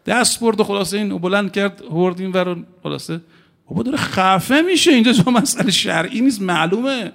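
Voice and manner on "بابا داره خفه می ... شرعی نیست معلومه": exasperated retold shout. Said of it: "exasperated retold shout at bystander".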